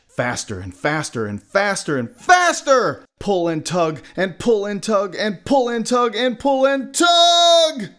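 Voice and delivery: escalating, effortful narration; building excitement. A narrator's reading. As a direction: chant building to a loud, high shout. Narrator acts out mounting struggle.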